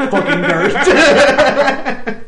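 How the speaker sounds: slow, high, laughing narration. Retold or imitated. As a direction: crack up as you tell it. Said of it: "he rolls a one and says".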